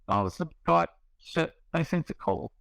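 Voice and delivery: normal talking voice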